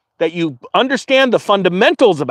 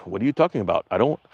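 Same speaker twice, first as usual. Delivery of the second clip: put-on Saudi Arabian accent; 11 semitones lower, 8 dB quieter, much faster than usual. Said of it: "that's not even a Saudi Arabian accent".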